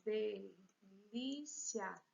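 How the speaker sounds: delícia very slow, de, lí-cia with a pause; syllable by syllable. Shown as a demonstration. Teacher showing syllables to students, delícia slowly broken apart. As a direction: count out the parts of delícia. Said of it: "Três sílabas".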